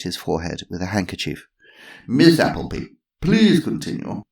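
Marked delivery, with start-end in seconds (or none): 2.1-4.2 higher, louder posh chairman; calm the room firmly; Sir Lancelot. Acted.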